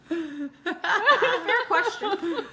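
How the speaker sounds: giggling through the words; laughing question. Real, not acted. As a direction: laugh while asking. Joking question to future self.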